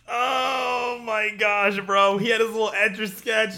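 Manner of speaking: high, loud, drawn-out wail of grief; anguished cry. Real, not acted. Friend recalling the Etch-a-Sketch scene, anguished wail. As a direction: cry out as if the scene still hurts.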